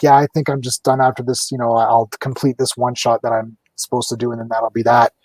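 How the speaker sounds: low, resigned retelling; disappointed. Retold or imitated. Retelling an artist quitting after the one shot, low mild disappointment. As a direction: imitate a let-down artist, pitched low. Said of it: "he was all disappointed".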